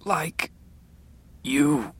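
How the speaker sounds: high, thin, halting words; weak, labored speech. A narrator's reading. Ailing father struggles to finish his thought, weak and halting. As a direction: push out one word at a time, faintly.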